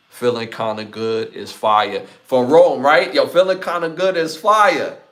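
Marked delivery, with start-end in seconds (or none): 2.2-3.1 excited. Real, not acted. Hyping the artist. 3.1-5.0 higher and louder, hyping the song; yo, get hyped about it. Real, not acted.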